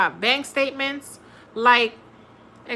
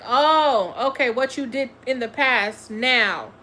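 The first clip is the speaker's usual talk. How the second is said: usual speaking voice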